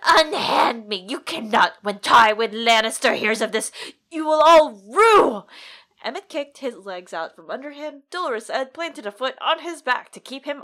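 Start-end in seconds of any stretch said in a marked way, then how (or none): 0.0-5.4 hammy stage actor. Acted. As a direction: rage at them as you're dragged off. Slynt dragged to the block.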